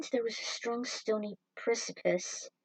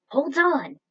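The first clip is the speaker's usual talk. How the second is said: urgent call; 6 semitones higher, 10 dB louder than usual. A narrator's reading. Partner stops the arrest.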